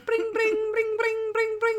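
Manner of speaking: playful high-pitched bring bring; bell imitation. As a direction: chirp it out like a bicycle bell. Garbage man keeps ringing the bell.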